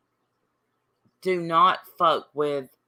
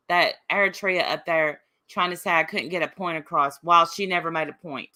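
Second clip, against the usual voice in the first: ordinary delivery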